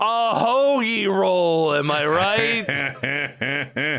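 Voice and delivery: drawn-out, lightly hammed-up pun; slow mock punchline. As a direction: slowly land the pun like a cheesy comic. Punchline in put-on voice.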